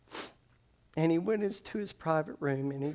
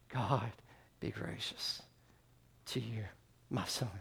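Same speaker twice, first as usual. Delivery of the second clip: soft, low, broken by emotional pauses; choked up; 4 semitones lower, 9 dB quieter than usual. Real, not acted. Preacher reading scripture to the church, deeply moved. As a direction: struggle to get the words out, deeply moved. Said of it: "I get so deeply moved".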